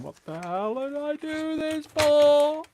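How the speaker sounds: high, drawn-out wail of regret; loud re-enacted scream, stretched out. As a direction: scream it like you're halfway round a roller coaster. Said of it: "Whilst also screaming".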